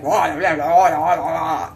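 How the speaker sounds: imitating rapid French Cajun gibberish; babbled Cajun "wah wah" imitation; mock French Cajun. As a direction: do a coworker's French Cajun talk in nonsense syllables. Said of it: "Talk French Cajun".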